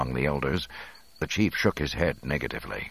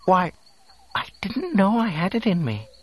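very high, wondering robot voice; shaken droid; 4 dB louder than usual. A narrator's reading. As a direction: marvel at yourself, still shaken. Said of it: "still a bit shaken".

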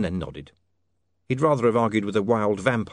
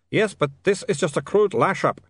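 high-pitched foreign vampire; accented vampire; 8 semitones higher, 3 dB louder than usual. Acted. Otto replies in accented voice.

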